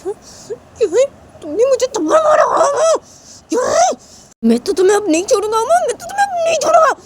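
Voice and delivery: put-on very high, loud shrieking boy; furious boy. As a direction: scream your revenge. Slapped Sanju shouting. (बहुत ऊँची गुस्से भरी चीख)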